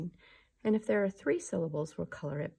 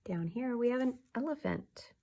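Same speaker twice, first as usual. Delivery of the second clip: ordinary talk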